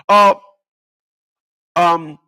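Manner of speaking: usual speaking voice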